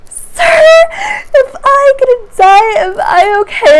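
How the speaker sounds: high, loud, tearful wail of panic; panicked crying plea. Retold or imitated. To the doctor ignoring her, a loud panicked sob. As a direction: cry out to the doctor in terror. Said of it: "And I started crying".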